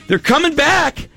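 high, excited cheer at the TV. Retold or imitated. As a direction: copy a wife cheering her team on. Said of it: "she's going like".

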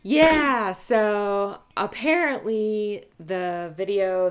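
loud, slow and keyed up; excited. Real, not acted. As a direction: still buzzing from the story. Teller carries on, thrilled.

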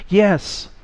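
high, eager exclamation; urgent high-pitched cry. A narrator's reading. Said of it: "the voice cried".

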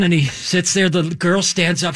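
ordinary talk

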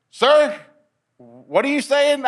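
high, bewildered query; retold baffled question. X-ray tech opening the door on patient, baffled. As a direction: act out a puzzled interruption, voice lifted.

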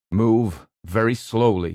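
slow, steady instruction; calm guidance. A narrator's reading. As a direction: guide her down calmly. Doctor guiding her.

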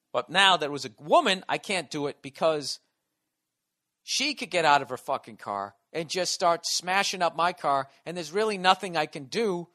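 usual speaking voice

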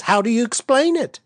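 high, insistent questioning; protesting. A narrator's reading. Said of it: "protested Miss Prudence".

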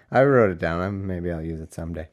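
ordinary talk

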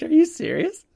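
high, incredulous squeak of a question; can't believe what he just heard; disbelief. Retold or imitated. Photographer reacting to the tow yard's name, high and incredulous. Hearing the name Camel Towing.

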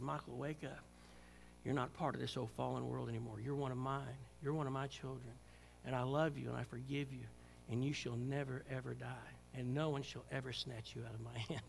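soft, low, tender voice of God; hushed loving reassurance; gentle divine whisper. Retold or imitated. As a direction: speak as if whispering in his ear. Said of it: "He whispered in my ear".

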